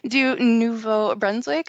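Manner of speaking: slow, careful French with English accent. Real, not acted. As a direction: stumble through French name slowly. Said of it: "my French is not good".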